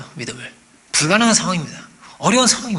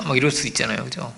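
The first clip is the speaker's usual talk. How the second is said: normal talking voice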